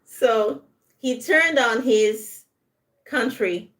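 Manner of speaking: turned stressed, sentence slow. Shown as a demonstration. Teacher modelling betrayal sentence, slow stressed turned. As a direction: linger on turned. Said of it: "we emphasize the word turn".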